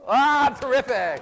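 loud, high, drawn-out praise; delighted cheer. Real, not acted. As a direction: cheer the performers on, slow and big. Applauding the twins' finished demonstration.

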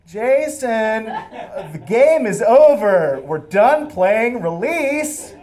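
loud, high, stretched holler; calling out. Acted. Teen calling his hidden friend for the cop's benefit, staged shout. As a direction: holler it slowly across a distance.

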